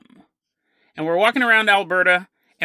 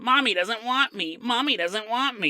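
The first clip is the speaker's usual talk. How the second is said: higher-pitched, sing-song muttering to oneself; creepy mumbling; 4 semitones higher, 3 dB quieter than usual. Pretend. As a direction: mumble it like a disturbed man facing the corner. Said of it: "It's the mumbling. You could be standing".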